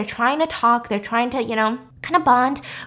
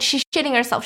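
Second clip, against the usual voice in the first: ordinary talk, nothing marked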